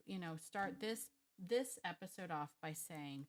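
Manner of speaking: ordinary talk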